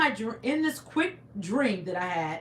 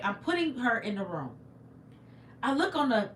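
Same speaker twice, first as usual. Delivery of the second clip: ordinary talk, nothing marked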